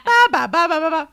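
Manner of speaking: high, loud babble laughing; mock chatter. Mocking the boyfriend's endless movie talk, laughing babble.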